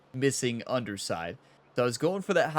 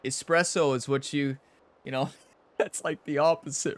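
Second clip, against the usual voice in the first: normal talking voice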